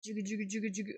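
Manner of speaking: soft, rapid rhythmic jigga noise; imitated jiggling noise. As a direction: chug out little working sound effects. Sound effect while wiggling piece.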